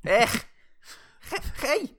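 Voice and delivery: high Scouse exclamation; Scouse accent. Pretend. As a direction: squeal it like a Liverpool lad. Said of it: "Harry Enfield School of Scouse".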